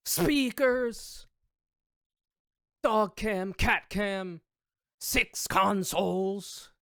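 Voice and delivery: high, loud hyped streamer shout; mock xQc voice. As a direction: hype up the gear list like xQc. Listing xQc's setup in his voice.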